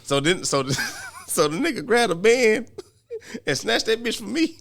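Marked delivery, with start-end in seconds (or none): 1.3-4.6 high, slow words broken by laughter; laughing through it. Real, not acted. Retelling how the band got snatched, laughing. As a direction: giggle the story out, much higher.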